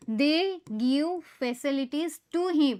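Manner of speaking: very slow, word by word; slow dictation. Shown as a demonstration. Teacher reading exercise sentence aloud, slow. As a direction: dictate each word slowly. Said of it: "Facilities કર્મ".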